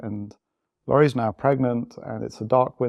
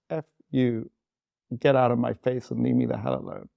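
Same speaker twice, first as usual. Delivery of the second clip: normal talking voice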